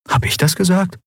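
put-on high, surprised boy's voice; wondering at himself. Boy startled by his own boldness, wondering. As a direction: ask it in amazement. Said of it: "wunderte sich Miko".

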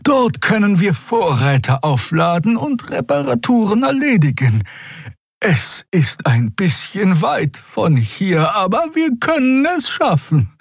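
high-pitched, measured character speech; high voice, earnestly suggesting; tentative figure voice. Acted. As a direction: explain the plan in a high, careful voice.

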